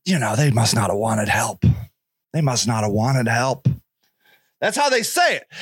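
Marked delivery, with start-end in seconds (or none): 0.1-3.7 much lower, smug know-it-all voice; smug judgment. Retold or imitated. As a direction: sneer as if you knew better. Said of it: "we judge them and we say".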